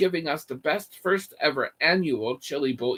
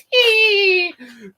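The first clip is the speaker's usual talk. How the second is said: high shrieking laugh; 13 semitones higher, 9 dB louder than usual. Real, not acted. Mid-story high burst of laughter.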